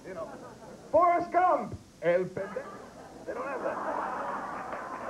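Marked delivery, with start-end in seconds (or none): 3.3-5.1 whisper. Real, not acted. Whispered aside.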